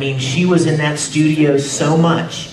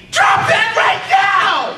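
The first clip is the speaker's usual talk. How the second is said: much higher, slow falsetto shout; Ed yell; much slower than usual. Shown as a demonstration. Actor demonstrating to the panel how Ed would yell, high falsetto shout. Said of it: "If Ed were to do that".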